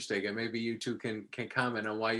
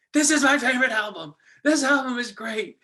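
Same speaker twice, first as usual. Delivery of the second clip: much higher and louder gushing praise; effusive fans; 12 semitones higher, 7 dB louder than usual. Retold or imitated. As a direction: gush like an offended superfan. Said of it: "people were effusive".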